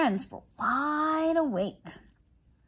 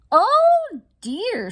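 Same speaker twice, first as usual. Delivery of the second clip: higher, louder alarmed cry; dismayed; 10 semitones higher, 7 dB louder than usual. Acted. Tobin fretting before the chinchilla match, dismayed cry. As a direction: gasp it out in mock alarm.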